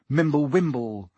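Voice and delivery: ordinary delivery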